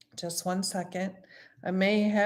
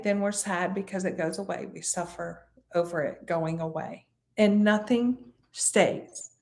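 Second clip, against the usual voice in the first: ordinary talk, nothing marked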